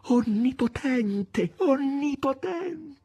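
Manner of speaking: very high, drawn-out adoring cry; enraptured. A narrator's reading. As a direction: stretch it out in rapture. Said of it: "aggiunse rapita".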